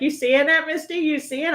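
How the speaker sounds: put-on high little-girl voice, asking eagerly; child Kaylee voice. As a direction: play the small niece showing her toy. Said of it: "and she's like".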